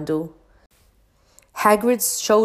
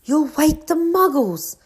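lightly voiced stern warning, higher; stern McGonagall; 9 semitones higher, 3 dB louder than usual. A narrator's reading. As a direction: scold him for the noise, higher. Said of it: "hissed Professor McGonagall".

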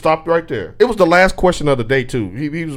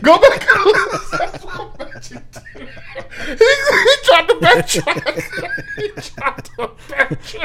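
wheezing laughter through words; laughing hard; much slower than usual. Real, not acted. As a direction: crack up mid-sentence. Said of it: "laughing hard".

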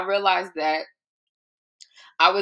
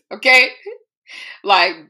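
loud; 3 semitones higher, 9 dB louder than usual. Real, not acted. Emphatic call-out to the listener.

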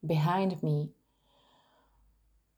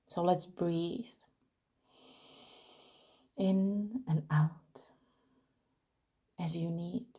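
guided meditation. Acted. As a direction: guide them gently into grounding, leaving room to breathe. Leads a grounding meditation.

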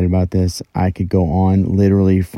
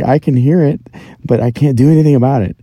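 exasperated; 6 semitones higher, 4 dB louder, faster than usual. Retold or imitated. Exasperated retold reply.

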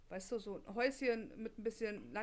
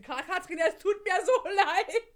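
high, loud embarrassed laugh-talk; 9 semitones higher, 11 dB louder than usual. Real, not acted. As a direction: squeal through embarrassment. Embarrassed by an intimate question. (verlegen lachend, hoch und laut)

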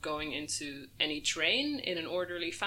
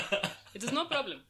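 fabricated Egyptian accent; 6 semitones higher, faster than usual. Shown as a demonstration. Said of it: "Do the voice".

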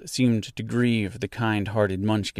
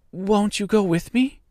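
put-on higher, begging child's plea; pleading; 10 semitones higher than usual. A narrator's reading. Dorothy begs the witch to come, pleading. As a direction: beg her not to leave. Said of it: "pleaded the girl".

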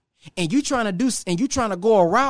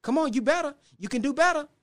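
higher, softer gentle urging; coaxing inner voice; 8 semitones higher, 3 dB quieter than usual. Retold or imitated. The inner voice urging the listener to do better, soft and higher. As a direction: nudge yourself to do better, lifted and soft. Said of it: "Siri on the inside is trying to tell you".

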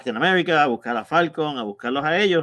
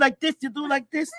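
higher, slow, hammed-up showing off the pose; mocking the hero pose, pitched up and slow; mock movie heroine line; 11 semitones higher, 3 dB quieter, slower than usual. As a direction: play it like the actress in that scene, higher and slower. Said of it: "tú haces esto cuando pones el puño".

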